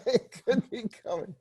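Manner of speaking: high-pitched, laughing quote; giggly hammed-up anticipation; laughing mock anticipation. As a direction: repeat it high through a laugh. Repeats his acted line, laughing.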